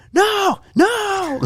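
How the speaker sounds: high, mock whining no; whiny refusal. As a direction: squeal it like a stubborn kid. Cramping player refuses mustard.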